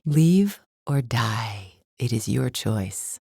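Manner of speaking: slow, low ultimatum; low, measured menace; cold threat. Acted. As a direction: deliver a menacing ultimatum, word by word. Villain delivers a cold threat.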